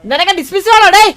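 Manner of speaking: loud, high threatening yell; angry shout. Acted. Boss threatens the man with dismissal, angry shout. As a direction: yell it out in sharp bursts.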